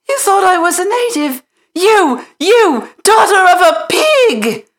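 put-on fast, very high, loud outraged girl; outraged girl screaming at the maid; furious. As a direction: spit out the insult. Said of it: "Mary sat up in bed furious".

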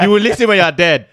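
mock preacher. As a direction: thunder it like a preacher. Mock sermon condemning name.

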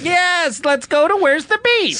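high, slow put-on old woman; old lady voice. Old lady customer agreeing brightly, high put-on voice. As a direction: chirp back like a sweet old lady.

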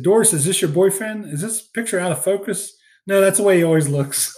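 lightly acted teasing exchange; teasing movie quote. Retelling the teammates ribbing Doris about her boyfriend's photo, teasing.

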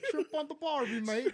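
high, loud mock Australian; Australian accent. Answering a friend's attempt at Australian, loud Australian accent. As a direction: belt out a jokey Australian accent. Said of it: "Australian accent is the same as British".

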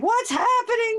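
high, mock-distraught question; retold tearful alarm, high-voiced; crying friend. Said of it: "You know, she's crying".

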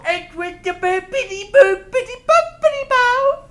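slow, high, loud nonsense babble; Cosby. Acted. Comic attempting an impression for co-hosts, loud silly Cosby babble. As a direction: bounce through the syllables in a hammed-up put-on voice. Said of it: "An Australian Cosby".

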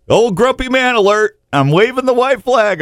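high-pitched mock announcement; mock alert. Host playfully surrenders to old age for Facebook friends, high mock alert.

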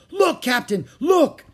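high, loud excited cry; gleeful shriek. Acted. Pinky to the captain with a find, gleeful shout. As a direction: shriek your discovery. Gleeful pirate shows gold.

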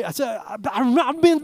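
ordinary talk, nothing marked

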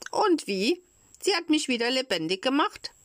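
put-on high-pitched, lively child's voice; happy puppet. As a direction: answer high and delighted. Cured puppet answers the fairy happily. (fröhliche, hohe Kinderstimme)

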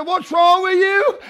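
high, loud exclaimed question; retold loud demand. Preacher to the crying woman at the altar, loud demand. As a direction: shout it, rising high on the question.

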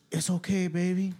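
mild soothing buddy voice; a hint of Marcos cooing to a drunk friend; imitating Marcos. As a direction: lightly do your friend's easygoing tone. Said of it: "Like how he talks".

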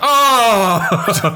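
high, loud gasp of outrage; shocked oh. Retold or imitated. Recalling hearing the wrong ending, high outraged oh. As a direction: react in outraged disbelief. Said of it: "Ich hab mir gedacht".

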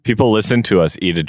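normal talking voice

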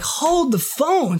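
high, slow "hold the phone"; startled disbelief. Retold or imitated. Realizing the kid is from Maine, stunned and high. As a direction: stop everything, stunned. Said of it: "I'm like".

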